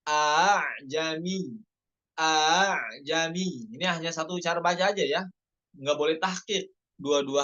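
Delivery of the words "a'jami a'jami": slow "A'jami" with the second hamzah eased; tashil of hamzah. Shown as a demonstration. Teacher demonstrating tashil slowly. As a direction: glide through the hamzah in "A'jami".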